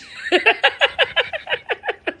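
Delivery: squealing high giggle. Real, not acted. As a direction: let out a high squeal of a laugh. Laughs about the inside jokes.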